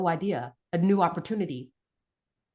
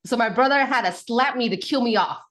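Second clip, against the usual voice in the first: ordinary talk, nothing marked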